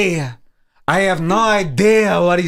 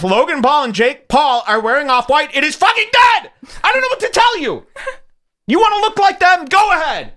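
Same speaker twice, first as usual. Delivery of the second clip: heated rant; 11 semitones higher than usual. Real, not acted. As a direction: blast the commenters, voice way up. Ranting against commenters.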